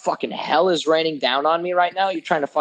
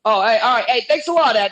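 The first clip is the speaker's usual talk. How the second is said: much higher, louder sarcastic thanks; sarcastic yell; 7 semitones higher, 5 dB louder than usual. Retold or imitated. Yelling a sarcastic thanks at the clerk Ed on the way out. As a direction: fling a mock thank-you at him while walking away. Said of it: "I just yelled".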